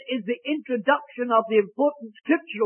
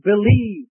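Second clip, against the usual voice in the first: "believe" said louder, with firm weight; stress on believe; 5 dB louder than usual. Shown as a demonstration. Preacher pointing out the emphasis in Galatians, stressing "believe". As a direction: punch the word "believe". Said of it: "the emphasis is on the word".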